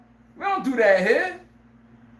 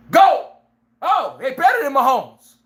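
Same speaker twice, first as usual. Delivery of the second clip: hype shout; 5 semitones higher, 4 dB louder than usual. Retold or imitated. Hype call for other quarterbacks.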